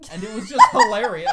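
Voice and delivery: high, loud laughing; loud real laughter; laughing hard. Real, not acted. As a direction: laugh through the words. Laughing about the quote.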